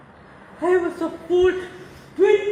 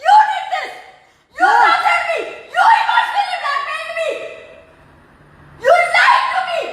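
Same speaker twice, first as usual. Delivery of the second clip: furious shout; 10 semitones higher than usual. Real, not acted. Daughter yelling at her father who hurt her, furious shouting. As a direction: scream the accusations at him, voice cracking high.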